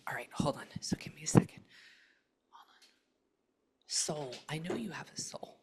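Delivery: hushed, breathy whisper to a spirit; a quiet medium's murmur; whispered séance. Acted. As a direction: whisper as if sensing someone near.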